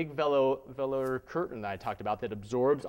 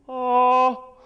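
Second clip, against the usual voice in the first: loud open ringing oh, much higher; open-throat oh; 11 semitones higher, 13 dB louder than usual. Shown as a demonstration. Teacher demonstrating an open tunnel with a ringing oh. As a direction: open the throat and let the oh ring out. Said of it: "I'll do the exact same amount".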